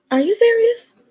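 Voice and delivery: high disbelieving squeal; astonished. Acted. Secretary amazed at getting the day off, squealing. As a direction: shoot up in delighted disbelief.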